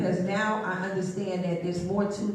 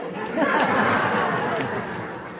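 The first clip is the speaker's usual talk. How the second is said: airy, wheezy laugh; breathy laugh; 5 semitones higher, 4 dB louder than usual. Real, not acted. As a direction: laugh it out in puffs. Laughs at own story.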